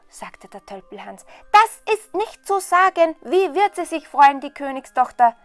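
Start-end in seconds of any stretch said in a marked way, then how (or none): 1.5-5.3 rapturous simpleton. A narrator's reading. Simpleton imagines the princess's joy at his find, rapturous. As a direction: gush over it in delight.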